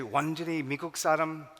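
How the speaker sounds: fast, pushy parental Korean; parents insisting. Retold or imitated. Son imitating parents demanding he become fully American, quick and insistent. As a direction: push it fast, like parents laying down the rule.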